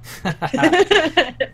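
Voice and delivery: high burst of real laughter. Real, not acted. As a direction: break up laughing out of character. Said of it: "an outside-of-character laugh".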